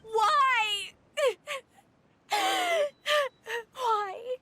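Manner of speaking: loud, high sobbing wail; tearful, high-pitched scream of protest; anguished cry. Acted. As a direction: cry out in despair. Condemned person cries out.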